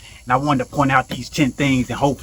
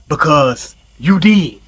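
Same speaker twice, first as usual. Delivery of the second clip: each word drawn out and pressed; a slow, deliberate gotcha; slow emphatic punchline; 5 dB louder, much slower than usual. Acted. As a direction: land it slowly, with weight. Punchline turning the advice around.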